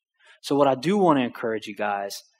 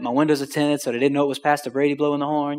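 ordinary talk

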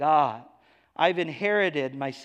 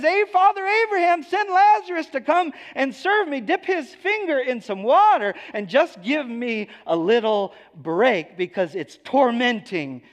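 much higher and louder, bossy and entitled; demanding rich man; 8 semitones higher, 5 dB louder than usual. Acted. Rich man in hell demanding Lazarus serve him, high and loud. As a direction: order Abraham around like a spoiled master. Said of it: "He says".

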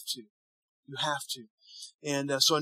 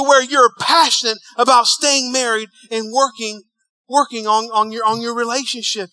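full-force preaching; 4 semitones higher, 6 dB louder than usual. Real, not acted.

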